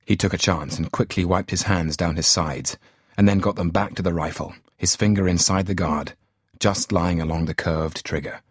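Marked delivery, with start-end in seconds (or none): none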